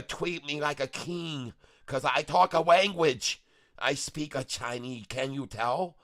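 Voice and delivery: lightly put-on broken-English Chinese accent, clipped grammar; a silly character bragging about speaking Chinese; mock Chinese accent. Character voice continues.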